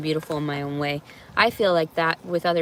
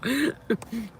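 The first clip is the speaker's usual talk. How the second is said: soft laugh; 6 semitones higher, 4 dB quieter than usual. Real, not acted. Laughs at herself.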